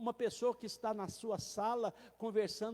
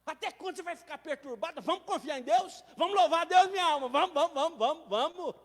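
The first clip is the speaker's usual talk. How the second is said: louder, rising urging that builds; fervent call to praise, growing louder; psalmist urging his soul; 4 semitones higher, 9 dB louder than usual. Acted. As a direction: spur your soul on, vamos vamos. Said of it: "O salmista".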